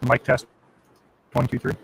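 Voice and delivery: robotic distorted mic; glitchy robot-sounding audio. Real, not acted. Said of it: "And it sounds like a robot".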